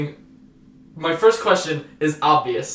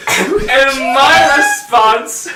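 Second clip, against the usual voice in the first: laughing; 8 dB louder than usual. Real, not acted. Still laughing while retelling messages.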